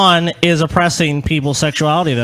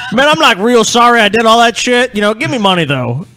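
mock unrepentant Strawberry; 5 semitones higher, 5 dB louder than usual.

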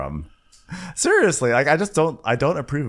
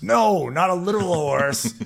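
higher exasperated correction; exasperated quote; 9 semitones higher than usual. Retold or imitated. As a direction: correct him, pitched up.

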